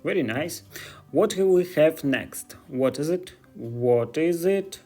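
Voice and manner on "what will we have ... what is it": ordinary delivery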